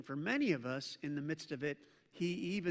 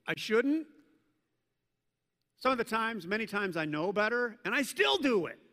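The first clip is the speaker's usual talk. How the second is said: preaching at full force; 5 semitones higher, 5 dB louder than usual. Real, not acted. Preacher rising in conviction.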